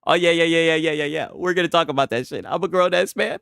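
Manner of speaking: ordinary talk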